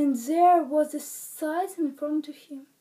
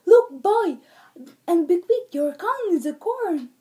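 urgent call; 4 semitones higher, 8 dB louder than usual. Acted. Leprechaun tries a trick.

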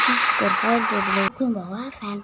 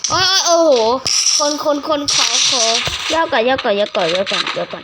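panicked yelling; 3 semitones higher, 7 dB louder than usual. Real, not acted. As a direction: yell in a sudden in-game panic. Sudden panic in game. (ตะโกนตกใจ)

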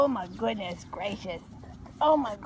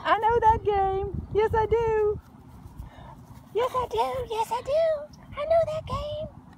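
high, louder puppy voice speaking for her; voicing the dog; 10 semitones higher, 3 dB louder than usual. Owner voices the puppy's thoughts, high and eager. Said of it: "Say, "But I know how to play it".

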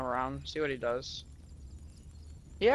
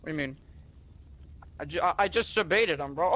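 normal talking voice

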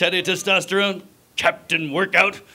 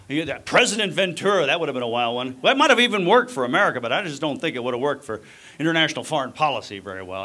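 normal talking voice

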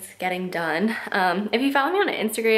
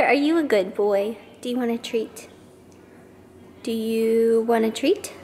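talking to a dog. Real, not acted.

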